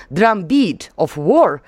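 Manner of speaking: slow, careful English with a Russian accent; Russian-accented quotation in English, word by word. Shown as a demonstration. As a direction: read out English deliberately, Russian accent showing. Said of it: "Простите моё произношение".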